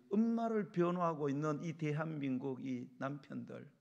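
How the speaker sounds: ordinary delivery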